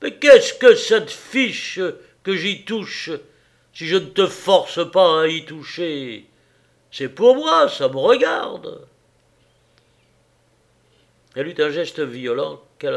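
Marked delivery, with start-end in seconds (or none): 0.0-1.8 slow, much louder and higher, heavy; louder, slower heavy retort; heavy repetition. Acted. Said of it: "il répéta lourdement". 2.2-8.9 louder, defiant husband; loud, obstinate husband; stubborn heavy insistence. Acted. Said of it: "il répéta lourdement".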